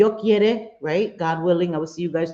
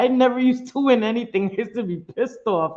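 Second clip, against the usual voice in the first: ordinary talk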